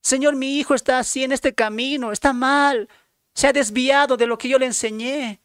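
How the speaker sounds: high, loud, desperate crying-out to God; pleading prayer. Acted. Preacher voicing a troubled parent praying, high loud pleading. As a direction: beg the Lord with everything you have. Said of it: "¿Ha suplicado".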